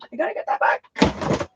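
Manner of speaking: a high, breathy whisper. Real, not acted. Aunt muttering to herself while rushing off for the book, whispered.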